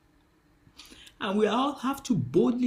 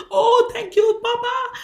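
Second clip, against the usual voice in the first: higher and louder, eager thanks; excited thanks; 11 semitones higher, 10 dB louder than usual. Retold or imitated. Pastor acting her own joyful thanks to God, higher and louder. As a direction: gush thanks to Papa. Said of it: "what did I say".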